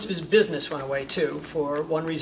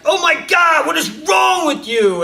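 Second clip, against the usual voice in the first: much higher, loud exasperated yell; mom yelling; 12 semitones higher, 8 dB louder than usual. Retold or imitated. Shrill furious mom shout, slowing at the end. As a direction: mom shouting at her failing kid in exasperation. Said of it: "your mom's like".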